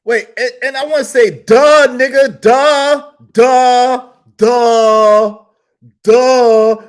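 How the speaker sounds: loud high exasperated duh; hammering duh at the guest; shouting duh. Real, not acted. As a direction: yell it in disbelief. Ranting outburst at guest.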